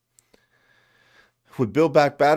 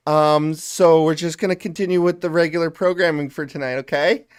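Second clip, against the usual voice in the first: loud, higher, forced perky greeting; fake cheerful composure; 4 semitones higher, 5 dB louder than usual. Mock streamer returning to viewers after trolls, forced cheerful voice. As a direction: put on an overbright streamer front after crying.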